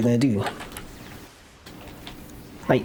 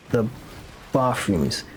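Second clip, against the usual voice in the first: ordinary talk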